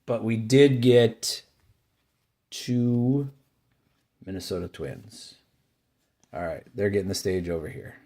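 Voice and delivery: usual speaking voice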